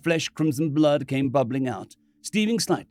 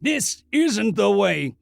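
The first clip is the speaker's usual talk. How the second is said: angry; 7 semitones higher, 4 dB louder than usual. Acted. Ronson protests angrily.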